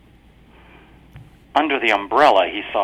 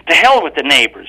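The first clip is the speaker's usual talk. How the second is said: louder, higher, furious wife; angry hiss; 6 semitones higher, 12 dB louder than usual. A narrator's reading. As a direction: spit the words at him. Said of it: "she hissed".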